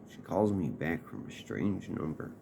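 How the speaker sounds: ordinary delivery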